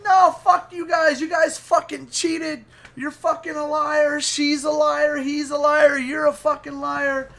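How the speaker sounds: high-pitched sore-loser rant; hammed-up high protest; mock angry accusing. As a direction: imitate an outraged friend, high and insistent. Acting out friend's angry accusations.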